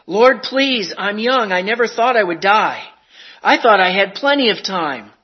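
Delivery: high, loud begging to the Lord; desperate pleading. Acted. Sinner at heaven's gate begging the Lord, desperate and loud.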